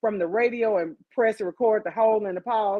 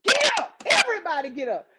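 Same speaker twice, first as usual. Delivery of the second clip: angry command; 6 semitones higher than usual. Retold or imitated. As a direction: wake the whole house in anger. Grandmother ordering kids up.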